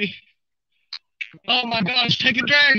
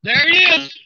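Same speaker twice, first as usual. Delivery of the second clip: higher, thrilled exclamations; excited; 5 semitones higher than usual. Real, not acted. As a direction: exclaim in amazed delight. Fish comes into view.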